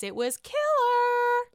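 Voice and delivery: high, slow it was killer; high drawn-out praise. Real, not acted. Vlogger congratulating Candace, drawing it out high. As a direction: stretch killer in delight.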